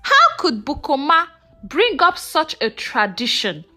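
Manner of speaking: ordinary delivery, nothing marked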